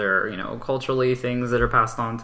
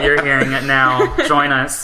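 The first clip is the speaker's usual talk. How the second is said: ordinary talk, nothing marked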